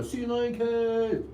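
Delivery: slow, stretched out, sung-out name; drawn-out announcer call. Shown as a demonstration. Fan at the caves, calling out the name slowly. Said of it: "you have to say it like that".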